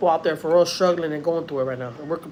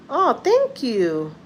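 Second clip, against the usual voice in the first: slow thanks; 6 semitones higher, much slower than usual. Retold or imitated. Moved reply to compliment.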